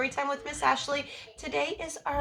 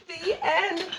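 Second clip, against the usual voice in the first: laughing; 4 semitones higher than usual. Real, not acted. Laughing after the story.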